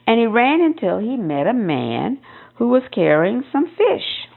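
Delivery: ordinary delivery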